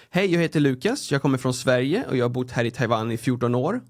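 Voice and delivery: speaking Swedish with its native melody; natural Swedish. Shown as a demonstration. Giving an example of how Swedish sounds, natural Swedish. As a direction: introduce yourself in everyday melodic Swedish. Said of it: "That's how, how Swedish would sound like".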